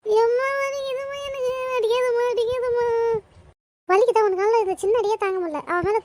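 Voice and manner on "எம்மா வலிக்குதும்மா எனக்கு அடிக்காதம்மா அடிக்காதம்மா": high tearful wail; crying. Acted. Smacked child begs mother to stop, crying. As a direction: plead through whining sobs.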